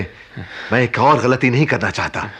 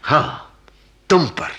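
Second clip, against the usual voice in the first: ordinary talk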